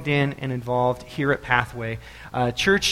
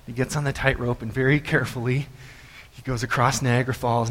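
usual speaking voice

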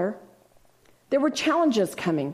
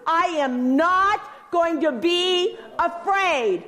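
slow, high, "not" punched; preacher models the answer for the crowd; slow declaration; 7 semitones higher, slower than usual. Shown as a demonstration. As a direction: declare "I am not going to be afraid" slowly, leaning on "not". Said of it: "What are you gonna do?".

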